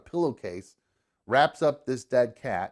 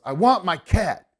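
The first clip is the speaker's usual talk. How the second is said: a higher, louder whiny demand; insistent stranger; 8 semitones higher, 8 dB louder than usual. Retold or imitated. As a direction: whine it like a stubborn stranger. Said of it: "And the guy goes".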